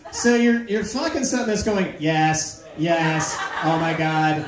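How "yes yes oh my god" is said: slow monotone robot moaning; mechanical robot voice. Acted. Sex robot flattering its owner, slow mechanical monotone. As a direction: drone it evenly like a machine. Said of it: "that same mechanical voice".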